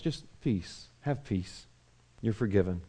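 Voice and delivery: soft, low, tender comfort; gentle hushed reassurance. Acted. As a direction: murmur it like forgiveness to a child.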